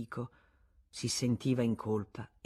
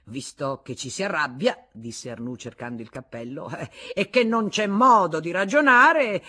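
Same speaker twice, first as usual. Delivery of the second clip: exasperated; 3 semitones higher, 10 dB louder than usual. Acted. Husband storming off, exasperated. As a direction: give up in anger.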